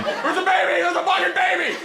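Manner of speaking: high screamed imitation, repeated; screaming. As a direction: scream it very high as if in a night terror. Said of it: "he is screaming over and over and over again".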